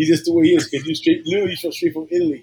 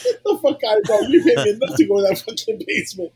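alarmed outburst; 7 semitones higher, 4 dB louder than usual. Retold or imitated. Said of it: "So I was like".

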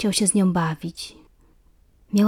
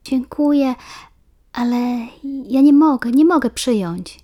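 shy refusal; 5 semitones higher, 5 dB louder than usual. A narrator's reading. Asia refuses the gift.

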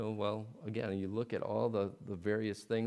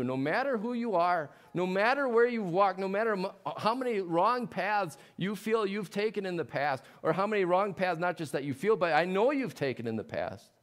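louder and higher, building with each no matter; rising preaching; 8 semitones higher, 5 dB louder than usual. Real, not acted. Preacher pleading with the congregation, building loud. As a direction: preach it out, climbing in force.

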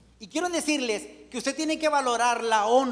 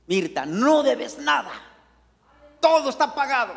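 God's loud proclamation; 4 semitones higher, 7 dB louder than usual. Acted. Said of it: "diciéndonos".

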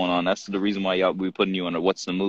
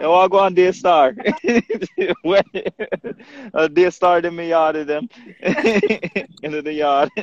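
put-on playful Jamaican accent, pitched up and laughing; 9 semitones higher than usual. Friend joking about the name Daystar in a Jamaican accent. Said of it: "that sounds Jamaican".

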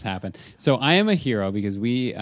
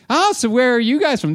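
high, loud caricature of a tourist; Barb; 11 semitones higher, 5 dB louder than usual. Retold or imitated. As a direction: pipe up nosily in a high mock voice. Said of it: "She's like".

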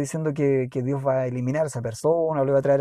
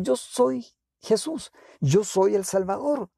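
slow, raised, drawn-out proclamation; emphatic declaration; 6 semitones higher, slower than usual. Acted. Jesus reveals himself to Saul, slow emphatic declaration. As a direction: proclaim it slowly, pitched up, word by word.

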